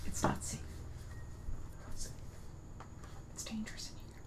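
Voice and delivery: hushed whisper; breathy warning whisper. Acted. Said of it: "you guys are whispering".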